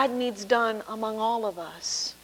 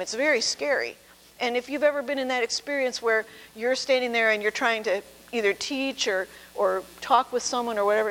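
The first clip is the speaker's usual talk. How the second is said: ordinary talk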